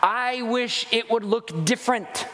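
whiny grumbling. Retold or imitated. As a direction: drag it out like a sulky complainer. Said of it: "They grumbled".

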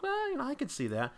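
way up high, half-sung concession; high falsetto I could see that; high mock musing. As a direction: float it up high like a grudging maybe. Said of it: "And I'm like".

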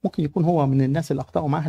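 normal talking voice

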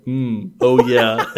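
loud hammed-up moan with laughter; mock bedroom groan; laughing mock moan. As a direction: overdo the pleasure and crack up. Acted moaning in read scene.

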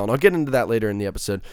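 ordinary delivery

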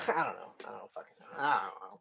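soft, breathless whisper; whispered aside. Real, not acted. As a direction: say it under your breath. Laughing aside, voice dropped.

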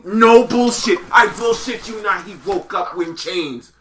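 ordinary delivery

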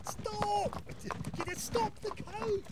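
very high, drawn-out cry; distant desperate shout. Acted. Inspector left behind chasing the coach, shouting desperately. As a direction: yell after it from far behind.

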